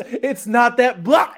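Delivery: laughing too hard to get the words out; laughing mock quote. Retelling a meme to friends, laughing through the quote. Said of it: "He goes".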